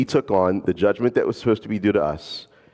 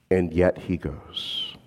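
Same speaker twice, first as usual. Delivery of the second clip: slow and softer, weighted; slow emphasis; 3 semitones lower, 5 dB quieter, much slower than usual. Real, not acted. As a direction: let it land gently. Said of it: "repeated slowly for emphasis".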